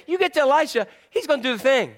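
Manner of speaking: lightly voiced excited girl; eager servant girl. Girl urging him to Elisha.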